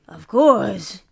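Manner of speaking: loud, hammed-up bad Spanish accent; terrible accent. Mimicking Ben Stiller's anchor for her co-host, bad Spanish accent. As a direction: fake a cheesy Spanish accent, badly. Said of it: "That's a terrible accent you're doing".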